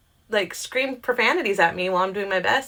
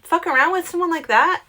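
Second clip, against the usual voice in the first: incredulous scolding; 11 semitones higher than usual. Retold or imitated. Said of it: "I'm like".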